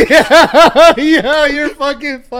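high, slow laughing words. Real, not acted. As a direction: laugh through it slowly. Cracking up at answer.

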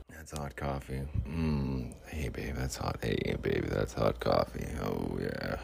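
very low, soft seducer voice; mock-smooth coffee come-on; smooth bling character. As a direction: drop it deep and hushed, smooth as can be. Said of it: "he'd be all smooth".

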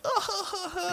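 very high-pitched imitated belly laugh; mock crowd laughter. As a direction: mimic an audience dying laughing. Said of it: "everybody's fucking dying laughing".

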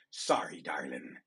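mock apology. Pirate whispers a fake apology to his betrayed mate. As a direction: murmur it to her as a sly aside. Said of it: "I say".

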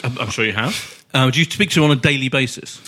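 ordinary talk

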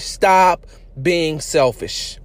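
every word of "Stop being selfish." drawn out slowly; slow and emphatic. Shown as a demonstration.

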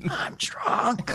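put-on high whispered drunken confession; hushed mock-drunk voice. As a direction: imitate a drunk whispering to his wife. Acting drunk husband whispering in bed.

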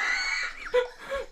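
real squealing laugh at a friend; high shrieking laugh. Real, not acted. Laughing at cohost's outburst.

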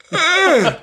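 high, drawn-out ugh; mock groan of disgust. Recalling the car in the puddle, a high disgusted groan. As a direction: groan at the ruined toy.

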